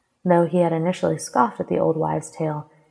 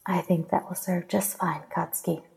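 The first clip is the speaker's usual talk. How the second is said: soft, intimate murmur; softly voiced goddess, close up; close, into the ear; 5 dB quieter than usual. A narrator's reading. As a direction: murmur it right into his ear. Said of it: "her hot breath fanning against".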